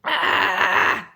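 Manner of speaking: loud open sigh after a drink; loud refreshed "ah". Real, not acted. Thirsty vlogger after a drink, loud satisfied "ah". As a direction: let out a big satisfied "ah".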